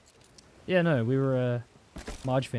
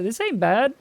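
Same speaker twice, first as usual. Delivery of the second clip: put-on higher, chirpy little kid; pleased surprise; 9 semitones higher, 5 dB louder than usual. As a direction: sound pleasantly surprised by the taste. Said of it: "And I was like".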